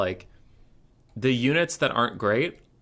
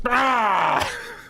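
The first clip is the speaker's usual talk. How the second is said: loud laughing shout; 5 dB louder than usual. Real, not acted. Laughing at gurgling voice line.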